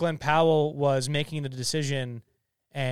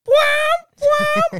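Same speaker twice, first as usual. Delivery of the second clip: loud high wham sound effect; door slam; 12 dB louder than usual. Shown as a demonstration. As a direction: slam it like a dramatic sting. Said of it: "just do a special announcement".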